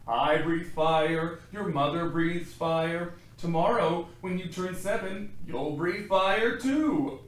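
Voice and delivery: slow, loud, proud dragon dad; proud dragon father. Acted. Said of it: "explains his father".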